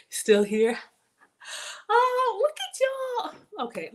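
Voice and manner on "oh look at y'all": high, slow gushing oh; touched gush. Real, not acted. Streamer reacts to a flood of chat compliments, high and slow. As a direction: melt at the kindness, high and drawn out.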